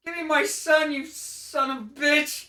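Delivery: high, loud mock fury; angry demand. Parent confronts old man over son, mock-angry demand.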